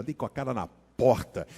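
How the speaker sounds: usual speaking voice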